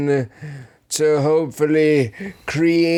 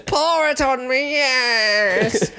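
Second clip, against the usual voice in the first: high silly voice; 7 semitones higher, slower than usual. Acted. Old-timey tavern bit.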